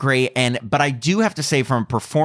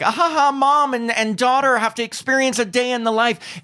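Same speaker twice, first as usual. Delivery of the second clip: much higher, cheesy sitcom parody; mock a corny family comedy; 10 semitones higher than usual. Mocking cheesy body-swap movies.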